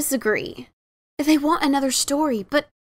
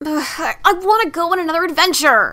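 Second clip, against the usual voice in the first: a louder, higher blurt of longing; 4 semitones higher, 4 dB louder than usual. Acted. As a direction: burst it out, unable to hold it in. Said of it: "Daring finally blurted".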